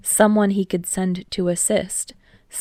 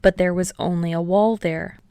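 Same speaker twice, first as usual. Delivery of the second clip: ordinary delivery, nothing marked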